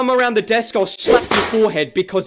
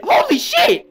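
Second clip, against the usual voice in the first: very high, loud shocked cry; reacting to a rude customer, squealing; 5 dB louder, slower than usual. Real, not acted. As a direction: lose it at what you see.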